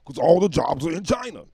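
high, loud comic outburst; shouted punchline. Pretend. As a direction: yell it out suddenly. Shouted punchline after soft list.

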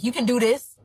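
usual speaking voice

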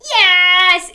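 high, loud celebration; triumphant yes. Pretend. Storyteller confirms children's guess, excited. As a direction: shout it to congratulate them.

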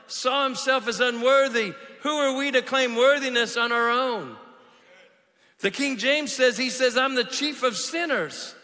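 loud, high, driving sermon delivery; full-force preaching. Real, not acted. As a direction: preach it hard to the whole room.

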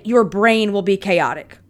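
ordinary talk, nothing marked

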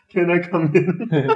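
repeating it through laughter, slowly; slow, giggling echo of the posh voice; laughing imitation. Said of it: "he straight away said that".

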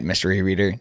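ordinary delivery, nothing marked

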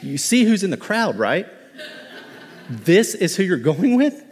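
mock-shocked heavenly onlookers; astonished angels. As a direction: play the angels, amazed and laughing. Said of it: "they're like".

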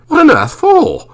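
utterly baffled by the fan; baffled exclamation. A narrator's reading. Marlowe baffled.

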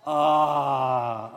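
a big, long, loud ahh with the crowd; a room-filling satisfied ahh; loud full ah. Shown as a demonstration. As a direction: really belt out the sigh of relief. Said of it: "now we're gonna really say it this time".

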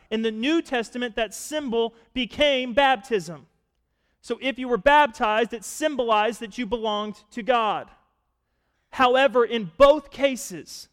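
impassioned preacher pressing the point home; full-force preaching. Acted. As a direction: preach it to the back row.

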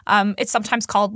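ordinary delivery

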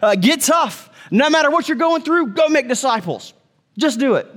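tough-guy coaching, pushy; hammed-up hard-nosed command; gruff mock pep talk. Mock tough command, misread of Paul.

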